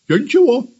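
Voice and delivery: high puppy voice; startled puppy. A narrator's reading. Puppy stopped by the kitten's shout, innocent. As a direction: answer up high, all innocence.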